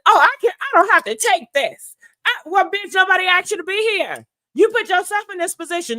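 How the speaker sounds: high, loud, fast mock-angry comeback; heated retort. Retelling the party fight, high and heated. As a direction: snap back like in the argument. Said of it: "And then Drew said".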